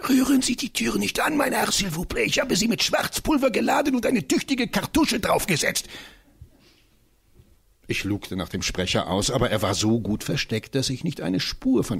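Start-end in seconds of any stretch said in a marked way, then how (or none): 0.1-5.9 high, urgent little professor voice, not really a whisper; a hammed-up excited warning, pitched high; excited hiss. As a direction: warn him off urgently from hiding. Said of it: "aufgeregt flüsternde Stimme".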